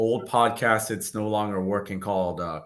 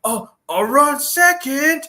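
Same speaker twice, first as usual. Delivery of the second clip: Master Splinter; 5 dB louder than usual. Retold or imitated. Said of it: "he still did his impression".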